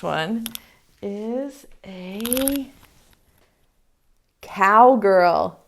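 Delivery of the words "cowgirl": ordinary talk, nothing marked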